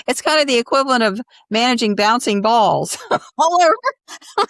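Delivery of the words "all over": high, slow words through laughter; laughing. Real, not acted. Host joking about managing creatives, laughing.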